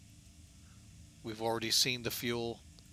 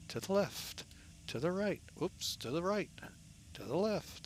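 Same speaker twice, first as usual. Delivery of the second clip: peppy higher sing-song fitness calls; aerobics instructor; 6 semitones higher, 4 dB quieter than usual. Acted. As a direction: lightly call it out like a workout coach. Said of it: "Sound just like an aerobics instructor".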